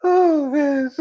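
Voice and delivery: high laugh, almost crying; wheezing laugh. Real, not acted. Still cracking up at her own story, laughing. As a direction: laugh until it hurts.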